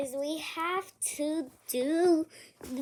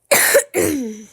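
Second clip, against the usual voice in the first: fake cough; 13 dB louder than usual. Sick patient acting out coughs.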